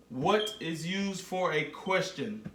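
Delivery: ordinary talk, nothing marked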